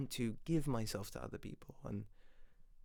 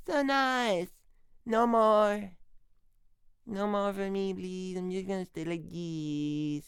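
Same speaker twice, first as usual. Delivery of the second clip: high and louder, a smug sapling refusing to grow; content little plant; 7 semitones higher, 4 dB louder, slower than usual. Retold or imitated. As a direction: voice a self-satisfied sapling. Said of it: "it was like".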